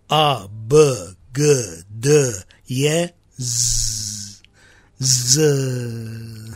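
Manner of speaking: spaced-out phonics, each letter as its sound, Z as a held buzzing "zzz". Shown as a demonstration. Priest demonstrating the sounds the letters make, spaced phonics. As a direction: sound out A, B, G, D, Ye, Z one by one, Z drawn out. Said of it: "the sound that the letters make".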